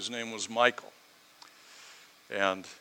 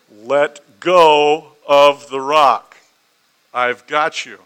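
very loud, slow, steady shout; calmly calling down; 15 dB louder, much slower than usual. Retold or imitated. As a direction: project each word down the cliff, firm and unhurried. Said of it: "he just calmly called down".

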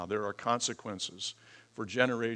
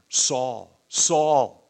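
Saul called twice, rising and louder; emphatic calling out; 4 semitones higher, 12 dB louder than usual. Shown as a demonstration. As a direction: call the name out with growing force. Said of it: "this is for emphasis".

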